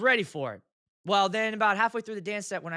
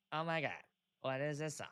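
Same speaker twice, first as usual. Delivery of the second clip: mock disapproval; 2 semitones lower, 8 dB quieter than usual. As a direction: mimic guests quietly appalled by the song. Imitates guests judging the song.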